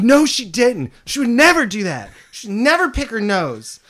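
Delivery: put-on high, indignant child protest; furious denial. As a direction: insist hotly she never would. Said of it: "I was furious".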